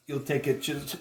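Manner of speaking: talking through a cough; coughing mid-sentence. Real, not acted. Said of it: "I'm coughing".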